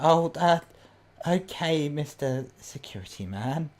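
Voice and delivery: put-on hesitant, taken-aback old woman; stammer, then draw out the title; startled old lady. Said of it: "her mouth is wide open".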